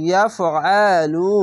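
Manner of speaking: ইয়াফআলু chanted slowly with a throaty আইন; slow recitation. Shown as a demonstration. Teacher modelling ইয়াফআলু, drawn out. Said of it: "আইন যাবার আ".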